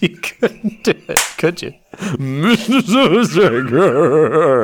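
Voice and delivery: high, slow, laughing drawl of the name; laughing tease. Real, not acted. Host teasing co-host who fumbled his name, laughing. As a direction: stretch the name out mockingly. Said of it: "it just boiled out of your mouth".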